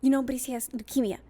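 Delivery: mildly scolding mom imitation; mad mother. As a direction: retell mom's cross announcement, only lightly acted. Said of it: "she was getting mad at me".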